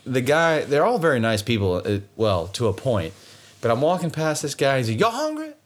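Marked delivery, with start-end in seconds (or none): 5.0-5.5 higher, thick Cajun twang offering food; Cajun backwater accent. Retold or imitated. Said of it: "that's exactly what he sounds like".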